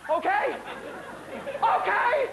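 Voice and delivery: high, hyped-up check; rallying the crowd. Acted. Comic to audience before studio take-off, hyped-up rallying. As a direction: call it high, pushing for a response.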